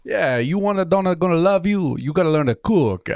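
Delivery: mock Italian nonno telling you to cook; bad Italian accent. Grandson performing his grandpa, in a hammed-up Italian accent. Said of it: "I know it's terrible".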